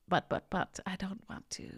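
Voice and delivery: soft, mock-reluctant foot-dragging; half-whispered stalling, acted out. Gardener voicing the dread before showing up, mock reluctant. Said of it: "that precursor feeling of".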